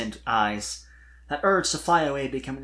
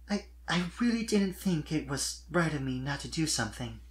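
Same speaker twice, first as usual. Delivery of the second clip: higher voice, hesitant and soft; shy young mare confessing; 5 semitones higher, 4 dB quieter than usual. A narrator's reading. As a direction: confess it, stumbling a little. Sweetie confesses to Spike.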